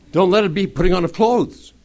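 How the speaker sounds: loud, high, emphatic repeat; insistent repeat. Real, not acted. Insistent repeat after "Did you hear me.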